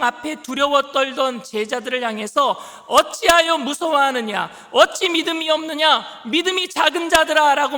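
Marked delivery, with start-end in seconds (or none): 2.9-7.8 forceful preaching. Acted. Quoted rebuke, preached forcefully.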